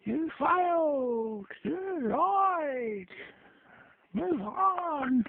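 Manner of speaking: very high, slow, drawn-out yells; hammed-up ranting neighbour calling out; angry neighbour shouting from across the street; neighbour's yelling. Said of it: "he always yells out".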